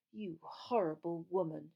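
tight, contained anger; through gritted teeth. A narrator's reading. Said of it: "through gritted teeth".

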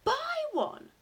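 put-on very high, loud boy's question; high-pitched incredulous yelp; astonished cry. A narrator's reading. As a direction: blurt it out in amazement. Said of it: "I cried".

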